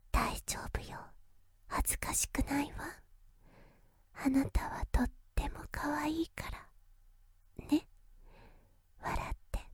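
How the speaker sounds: soft reassuring whisper. Acted. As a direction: whisper reassurance close to the ear. Soothing nervous lover at ear.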